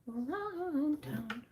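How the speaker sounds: slow sing-song around town; playful drawn-out. Shown as a demonstration.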